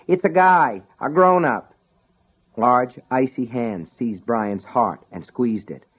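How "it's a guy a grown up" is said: calling up. Acted. Sean calling upstairs.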